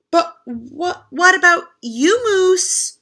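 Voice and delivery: high, worried asking; worried question. Acted. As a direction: ask with concern. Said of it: "the friends said as one".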